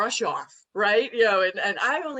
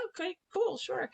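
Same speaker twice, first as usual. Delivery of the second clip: high, soft retold agreement; tentative acceptance; 8 semitones higher, 6 dB quieter than usual. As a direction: mimic going along politely, small high voice dropping. Acts out her hesitant polite acceptance.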